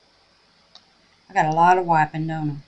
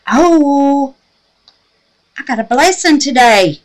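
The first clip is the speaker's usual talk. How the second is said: much higher, loud happy cry; joyful outburst; 8 semitones higher, 14 dB louder than usual. Real, not acted. Telling viewers about her blessing, loud joy. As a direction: burst out with delight.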